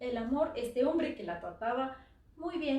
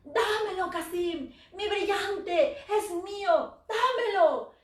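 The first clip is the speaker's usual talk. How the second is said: high, loud screaming demand; shouting from the room; 9 semitones higher, 10 dB louder, slower than usual. Acted. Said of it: "María gritaba desde el cuarto".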